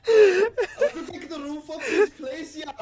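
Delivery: put-on high, loud, laughing German accent; mock German accent. Friend plays German partygoer, mock German accent. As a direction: giggle as a German raver. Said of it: "someone who's moved over from Germany".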